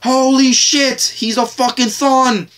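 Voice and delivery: high, drawn-out mock yell of disbelief; re-enacted yell at the screen. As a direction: relive yelling at the TV in amazement. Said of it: "I was just yelling at the screen like".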